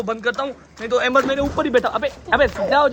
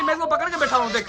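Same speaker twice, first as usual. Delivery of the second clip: usual speaking voice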